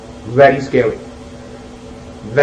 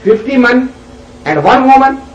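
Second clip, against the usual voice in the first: surprised; 7 semitones higher than usual. Retold or imitated. Woman amazed at the question.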